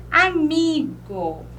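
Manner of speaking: "Amigo" slow, MI stretched; penultimate stress. Shown as a demonstration. Teacher giving a paroxytone example, "Amigo" lingering on MI. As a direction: draw out the mi in "Amigo". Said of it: "o mi".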